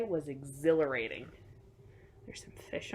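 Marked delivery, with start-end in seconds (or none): 2.3-2.9 fast, light whisper; quick whisper. Acted. Showing the children the fish, a quick whisper. Pointing out picture detail to kids.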